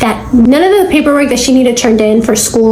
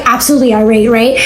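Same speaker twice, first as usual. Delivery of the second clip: normal talking voice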